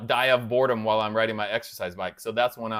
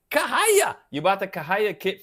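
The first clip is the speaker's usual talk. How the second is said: Kahaya squealed very high with a rising lilt; high, louder delighted echo of Kahaya; amazed; 7 semitones higher, 5 dB louder than usual. Real, not acted. Echoes brand name in delight.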